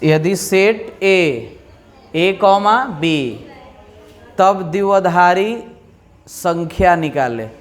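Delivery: slow, word by word dictation; dictating. Shown as a demonstration. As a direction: spell it out for notes. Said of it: "लिखिए". (धीरे-धीरे लिखवाते हुए)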